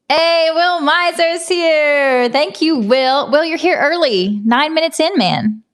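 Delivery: loud, high, drawn-out hello to a friend; excited greeting. Real, not acted. Greeting early viewer Will on stream, loud and excited. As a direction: call out happily as he arrives.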